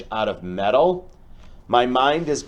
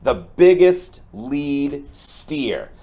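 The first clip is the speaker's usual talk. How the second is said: building up the stampede, bright and energetic; lively storybook read-aloud; 3 semitones higher, 3 dB louder than usual. Acted. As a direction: make the rescue exciting for the kids. Teacher reads picture book aloud.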